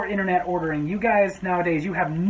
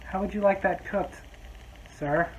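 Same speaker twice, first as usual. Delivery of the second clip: softer, strained service voice; forced polite; 6 dB quieter than usual. Retold or imitated. Server asking the customer, softer forced politeness. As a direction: choke down the irritation politely. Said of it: "So I just walked back up and went".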